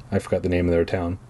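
ordinary delivery

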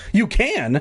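high surprised question. A narrator's reading. Bee finally heard by the boy, surprised. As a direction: lift it high in disbelief. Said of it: "I was surprised".

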